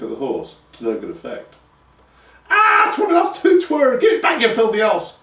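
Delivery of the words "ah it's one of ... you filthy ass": high, loud crone screech; screeching old crone. A narrator's reading. Crone screeching at the horse over her lost tooth. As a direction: spit the words out in a toothless fury. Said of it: "she screeched".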